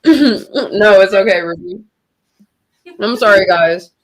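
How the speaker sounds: talking with food in mouth; mouth full. Real, not acted. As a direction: speak through a mouthful. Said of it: "I have food in my mouth".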